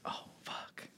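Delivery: nearly voiceless, low whispered panic; under-the-breath "we're done for"; hushed dread. Retold or imitated. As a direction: whisper it as the trouble sinks in. Realizing they are in trouble.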